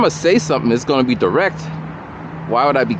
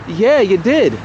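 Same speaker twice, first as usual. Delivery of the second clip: lightly voiced woman, a bit higher; a hint of her hurt, prim reply; mildly mimicking her offense; offended woman; 9 semitones higher than usual.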